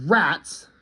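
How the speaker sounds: "Rats" as a statement, falling; mild mock yell of RATS at normal volume; a flagged alarm word, only lightly called out; retold yell. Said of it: "the dogs just yell".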